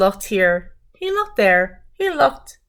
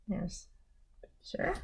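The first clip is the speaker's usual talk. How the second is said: whispered aside; 18 dB quieter than usual. Real, not acted. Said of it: "aside to children".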